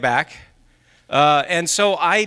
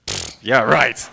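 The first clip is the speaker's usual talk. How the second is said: lower, dismissive "Pfft, yeah, right"; sarcastic scoff; 8 semitones lower, much slower than usual. Retold or imitated. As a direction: scoff at the claim like a skeptical brain. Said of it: "and your brain says".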